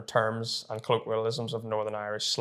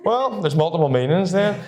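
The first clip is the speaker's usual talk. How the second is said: surprised; 6 semitones higher, 6 dB louder than usual. Real, not acted. Reacting to correction.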